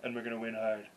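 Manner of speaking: lightly voiced Trump, low and soft; a mild take on Trump's rally cadence; understated Trump rally speech; Donald Trump impression. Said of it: "Could you do that Trump impression?".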